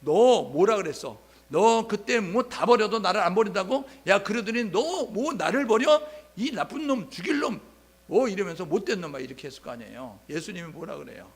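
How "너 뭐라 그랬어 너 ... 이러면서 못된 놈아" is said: mock scolding. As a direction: roll up your sleeves and berate him. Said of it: "손매 걷어붙이고". (소매 걷어붙이고 베드로를 크게 꾸짖듯)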